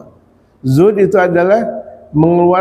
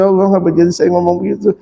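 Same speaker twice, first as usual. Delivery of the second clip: retold crying prayer; faster than usual. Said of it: "Nangis saya".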